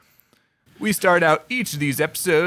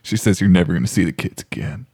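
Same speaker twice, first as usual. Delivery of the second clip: low deadpan announcer; 10 semitones lower, faster than usual. Acted. Mock sportscaster low voice.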